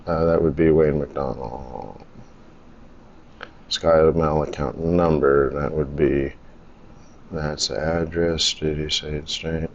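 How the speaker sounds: low, quiet self-talk; muttering while typing. Real, not acted. Man filling in an online form, muttering to himself.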